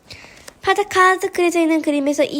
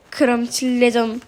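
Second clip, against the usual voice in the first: slow, drawn-out courteous warning; deliberate polite announcement; 2 semitones lower, slower than usual. Acted. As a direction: stretch each word out before the big move. Detective formally announces signature move.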